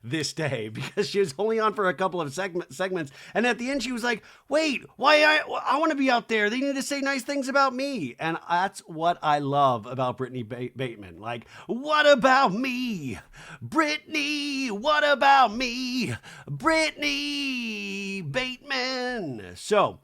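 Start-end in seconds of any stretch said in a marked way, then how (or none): 4.5-8.1 high, put-on voice of someone feeling left out; mock pleading. Brittany wants to be out there, high mock pleading. Acting whiny left-out housewife. 11.6-19.5 high, drawn-out, singsong mock plea; mock attention-seeking wail. Left-out housewife begging for attention, high mock wail.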